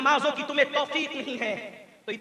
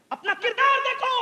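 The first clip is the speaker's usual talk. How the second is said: high, ringing command; raised preacher's voice; exhorting cry; 12 semitones higher, 5 dB louder than usual. Real, not acted. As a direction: call them sharply to account.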